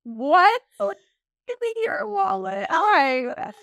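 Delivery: high, loud mock swagger; mocking boastful man. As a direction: ham up a man pretending to pay. Mocking a man who borrows money.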